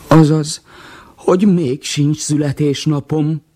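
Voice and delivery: high, slow crestfallen whine; disappointed. Acted. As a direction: let the hope collapse, slowly. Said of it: "keseredett el".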